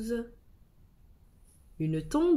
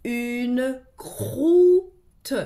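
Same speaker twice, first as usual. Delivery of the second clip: "croûte" sounded out louder, with the cr pulled apart; stretched, sounded-out "croûte"; 2 semitones higher, 6 dB louder than usual. Shown as a demonstration. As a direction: sound out "Une croûte" for a child. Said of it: "Les mots sont des syllabes qui se donnent la main".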